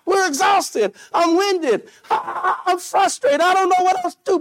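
hammed-up outcry, pitched high; crying out. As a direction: call out like a worn-out believer. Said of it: "We're crying out".

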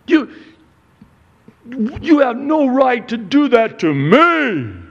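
high, loud indignant protest; indignant spouse. Retold or imitated. Pastor voicing a proud spouse's indignant protest, high and loud. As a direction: protest like you've been wronged.

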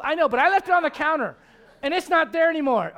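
high, loud, helpless protest; protesting kid. Acted. Grown child explaining the lost Bible to a parent, protesting. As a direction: plead your case like an overgrown child.

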